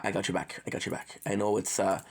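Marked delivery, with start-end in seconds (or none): 0.7-1.2 soft whispered reassurance; whispering. Real, not acted. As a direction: whisper it close and gentle. Reassuring a friend, then whispered.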